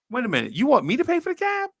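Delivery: hammed-up incredulous question; mock shock. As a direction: blurt it in disbelief.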